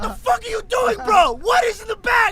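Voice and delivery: high, loud, furious yell; angry guard shout. Retold or imitated. TSA guard yelling at the confused traveler, angry shout. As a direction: scream it like a guard losing it. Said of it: "The guy's like".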